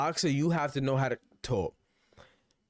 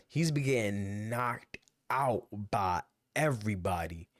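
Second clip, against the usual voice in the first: "he's been getting knocked out by everybody" drawn out slowly and lower; slow emphasis; 4 semitones lower than usual. Real, not acted. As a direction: let each word sink in, slow and heavy. Repeats point for emphasis.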